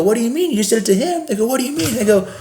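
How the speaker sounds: high, indignant comeback; retold angry retort. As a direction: argue back, worked up and high. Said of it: "And I go".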